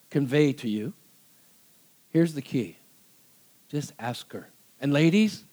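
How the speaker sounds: ordinary talk, nothing marked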